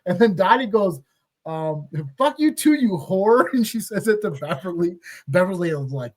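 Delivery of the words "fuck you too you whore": mock outburst, pitched up and louder; retold angry retort. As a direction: hurl the insult back, half in play. Retold insult thrown back in court.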